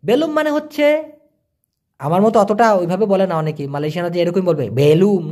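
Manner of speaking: ordinary talk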